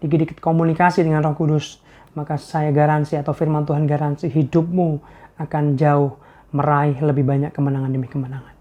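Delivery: normal talking voice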